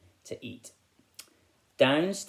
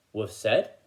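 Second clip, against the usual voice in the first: ordinary delivery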